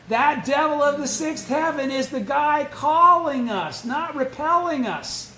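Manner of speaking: loud, fervent sermon build; forceful preaching. Acted. Teacher exhorting study group members about obstacles, loud fervent preaching. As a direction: drive the point home to the congregation.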